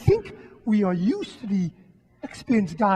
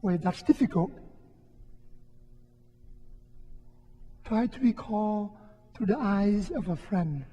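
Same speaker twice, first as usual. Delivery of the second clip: guided meditation; 3 dB quieter than usual. Acted. Priest guiding the congregation through a quiet prayer exercise, soft and unhurried. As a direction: lead the congregation gently into memory. Leads a reflection exercise, eyes closed.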